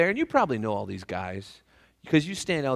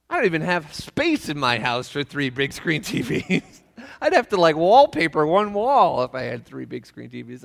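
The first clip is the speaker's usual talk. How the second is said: incredulous; 3 semitones higher, 6 dB louder than usual. Retold or imitated. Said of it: "And I was like".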